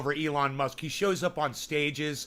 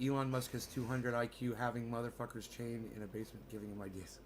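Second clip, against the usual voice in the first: reading script; 4 semitones lower, 10 dB quieter than usual. Shown as a demonstration. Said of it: "I have a funny script".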